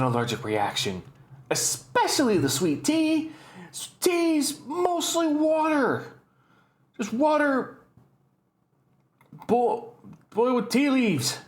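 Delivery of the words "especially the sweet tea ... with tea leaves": high-pitched, incredulous rant; exasperated disbelief. Real, not acted. Exasperated rant at absurd allergy claim.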